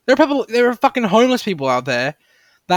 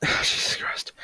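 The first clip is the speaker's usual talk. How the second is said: sighing, breathy whisper under the breath; exasperated whisper; 8 dB quieter, slower than usual. Real, not acted. Fed up with water hoarders, a sighing whisper. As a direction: mutter it to yourself in disbelief.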